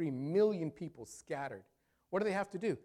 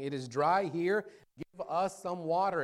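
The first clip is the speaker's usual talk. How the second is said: louder, pleading cry for water; the thirsty people begging Moses, voiced as a louder cry; 4 semitones higher, 6 dB louder than usual. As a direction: call out to Moses, desperate and parched. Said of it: "they're crying out to Moses".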